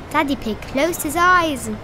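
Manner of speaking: ordinary delivery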